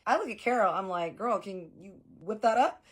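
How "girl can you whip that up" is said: sassy request. Retold or imitated.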